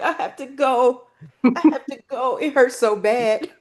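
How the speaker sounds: a child in pain, lightly played; mock crying. Hurt girl telling the boy she must leave, mock tearful. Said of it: "And I started crying".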